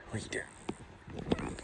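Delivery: soft breathy whisper, slow. Real, not acted. Whispering to the calf late at night, slow hushed. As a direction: hush it so nobody wakes.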